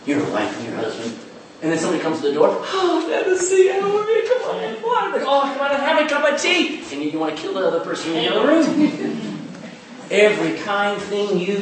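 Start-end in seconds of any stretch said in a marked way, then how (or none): 2.6-6.8 fake sweet host. Spouse faking cheer for a visitor after a fight. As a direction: gush over the guest like nothing happened.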